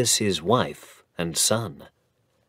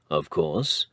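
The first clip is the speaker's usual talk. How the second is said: usual speaking voice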